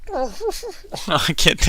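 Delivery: ordinary talk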